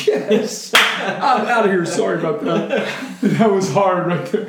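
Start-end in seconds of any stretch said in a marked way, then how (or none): 0.1-1.9 laughing through a mock escape line. Retold reaction, laughing. 1.9-2.7 laughing. Retold or imitated. Retold reaction, laughing.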